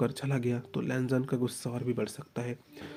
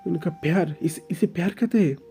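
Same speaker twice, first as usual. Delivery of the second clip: put-on higher, louder hurt young man, stammering; anguished outburst; 4 semitones higher, 5 dB louder than usual. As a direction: pour out the pain in a rising outburst. Oing's outburst about pain. (दर्द भरा फूटता हुआ ग़ुस्सा)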